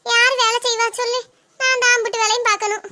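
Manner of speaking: ordinary talk, nothing marked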